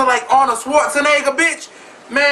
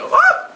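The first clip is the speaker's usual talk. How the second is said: a sudden high squeal of laughing delight. Pretend. Gushing over Scrappy with a squealing laugh.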